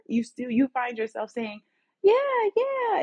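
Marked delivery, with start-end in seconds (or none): 2.0-3.0 very high-pitched, put-on agreeable yes; people-pleasing agreement.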